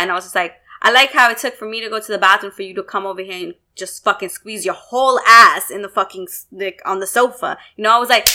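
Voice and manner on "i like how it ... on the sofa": normal talking voice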